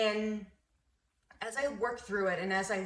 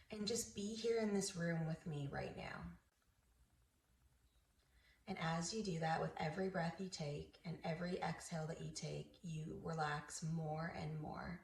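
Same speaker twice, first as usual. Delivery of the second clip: soft, soothing meditation voice; guided meditation; 2 semitones lower, 8 dB quieter than usual. Acted. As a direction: ease the listener gently into relaxation, softly.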